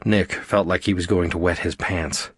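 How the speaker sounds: ordinary delivery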